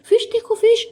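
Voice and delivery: very high, loud imitation yell; hammed-up overacting at full pitch; mock shouting. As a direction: shriek it in exaggerated excitement. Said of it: "ऐसे कहीं भी चिल्लाने लगती है".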